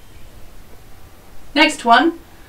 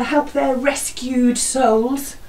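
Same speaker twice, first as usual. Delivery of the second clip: usual speaking voice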